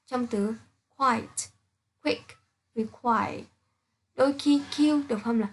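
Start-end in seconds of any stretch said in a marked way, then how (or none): 2.0-3.4 "quick" and "require" said very slowly with a kw sound; slow qu demo. Shown as a demonstration. Teacher modelling the kw sound in "quick" and "require" for students. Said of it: "Q thường được phát âm là Q".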